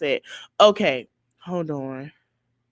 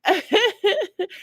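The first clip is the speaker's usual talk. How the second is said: high-pitched laugh; short real cackle; burst of laughter; 12 semitones higher than usual. Real, not acted. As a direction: crack up briefly. Laughing at played clip.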